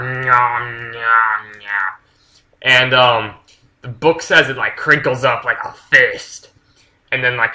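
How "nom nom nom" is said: mock chewing noises; munching sounds. Retelling a book's monster car munching, mock chewing. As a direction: nom nom like a monster eating.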